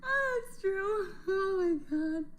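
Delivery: high, slow, half laughing half crying. Real, not acted. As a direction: squeeze it out through tears of laughter. Laughing to tears.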